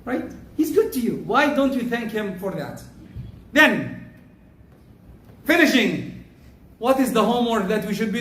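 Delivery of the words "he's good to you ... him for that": ordinary talk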